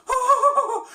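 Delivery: high cartoon titter; Shaggy nervous laugh. Shown as a demonstration. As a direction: laugh scared through the nose and breath.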